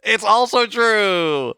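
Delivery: high, loud, slow gloating "It's also true"; gleeful reveal. Acted. Quiz host gleefully tells friend the answer is true. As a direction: stretch it out to rub it in.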